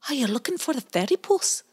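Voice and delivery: put-on high, quick baby otter voice; chirpy little otter asking; tiny otter. The otter greets Pie Jam, high quick otter voice. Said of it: "and said".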